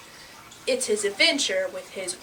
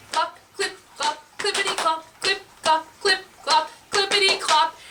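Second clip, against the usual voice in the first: rhythmic clip-clop; hoofbeat imitation; 6 semitones higher than usual. A narrator's reading. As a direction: trot away with the voice.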